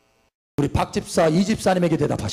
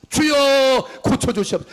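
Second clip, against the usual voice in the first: crying out; 6 semitones higher, slower than usual. Retold or imitated. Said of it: "소리소리 질렀어요".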